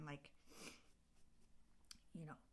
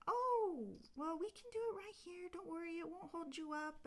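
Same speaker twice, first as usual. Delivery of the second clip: fairy voice; 12 semitones higher, 3 dB louder than usual. Retold or imitated. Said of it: "And they're like".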